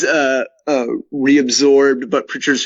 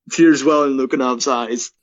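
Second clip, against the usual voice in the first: ordinary talk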